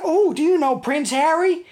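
high-pitched mock-eager question; excited American tourist. Imagined American quizzing a British tourist, high and eager. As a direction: imitate a starstruck stranger, pitched right up. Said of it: "And he said".

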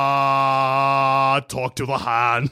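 slow, drawn-out Arnie-style catchphrase; Arnie accent. Acted. Quoting the robot's catchphrase, in a slow Arnie accent. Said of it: "I'm doing an Arnie accent".

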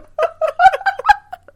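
real squealing laugh; high laugh. Real, not acted. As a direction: burst out laughing. Laughing at the bird bit.

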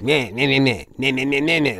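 mock nagging nonsense syllables; passive-aggressive yammering, lightly imitated; imitated bickering. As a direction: mimic petty back-and-forth squabbling. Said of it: "it was a lot of just bickering".

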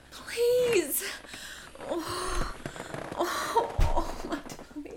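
breathy moans of a sick stomach; whispered pained plea. Acted. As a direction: whisper it through the pain. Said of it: "sick character pleading".

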